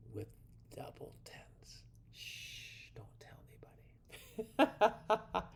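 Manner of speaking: hushed, playful stage whisper with a shush; secretive whisper. Pretend. Shares a secret hint, shushing.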